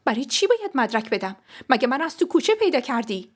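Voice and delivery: angry rhetorical questions; indignant retort. Acted. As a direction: demand an answer, affronted. Angry quarrel with fiancé over proof.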